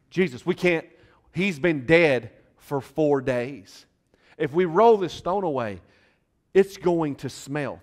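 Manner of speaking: high, loud, slow objection; protesting. Retold or imitated. Martha protests to Jesus about the tomb, loud. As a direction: push back hard against the order. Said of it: "Martha protests".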